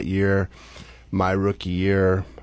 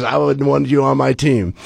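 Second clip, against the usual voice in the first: louder, faster, hearty praise; gruff approval; 7 semitones higher, 6 dB louder than usual. Retold or imitated. Old-school manager to his young catcher, loud hearty approval. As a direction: slap him on the back with it.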